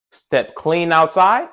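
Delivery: usual speaking voice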